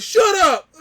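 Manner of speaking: very high, loud mimicked yell; mock furious shriek, hammed up; brother getting mad. Angry brother silencing him, imitated high-pitched yell.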